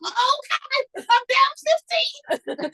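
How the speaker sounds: high, slow dismayed wail; hammed-up panic as the trade drops; rising mock despair over losing money; mock panic whine. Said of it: "I'm like".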